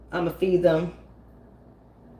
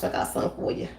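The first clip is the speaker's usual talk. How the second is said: menacing whisper; 6 dB quieter, faster than usual. Retold or imitated. Threat to an intruder.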